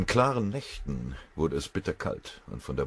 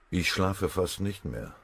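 ordinary delivery

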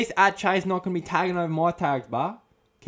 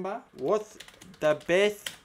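ordinary talk

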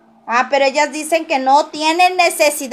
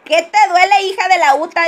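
laughing read; 6 semitones higher, 5 dB louder than usual. Real, not acted.

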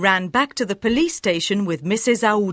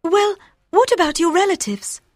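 Aouda reassuring Fogg; tender young woman; 2 semitones higher than usual. A narrator's reading.

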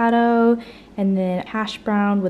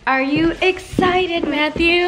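excited; 9 semitones higher, 7 dB louder, slower than usual. Real, not acted. Asks child before concert.

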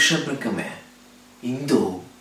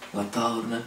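normal talking voice

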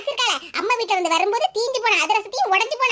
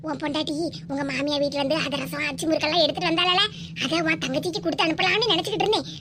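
ordinary talk

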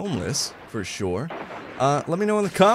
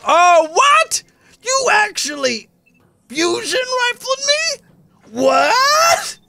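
high, slow outraged disbelief; shocked shout; 5 dB louder, much slower than usual. Real, not acted. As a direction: yell it in stunned outrage. Outraged shock at being killed.

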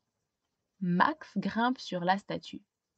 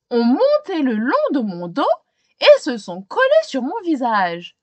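louder, fussy complaining policeman; policeman's voice; 6 semitones higher, 8 dB louder than usual. Acted. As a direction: gripe about the hair on his face.

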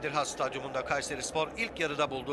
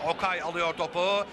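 ordinary delivery

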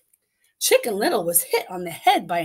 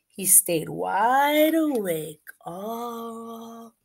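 slowing down sleepily; much slower than usual. Acted. Papa falls asleep.

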